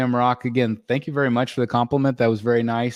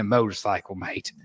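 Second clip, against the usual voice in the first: Jack Sparrow; 3 semitones lower, 5 dB quieter than usual. Acted. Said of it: "my bad Jack Sparrow".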